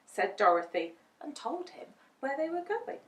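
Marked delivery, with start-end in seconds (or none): none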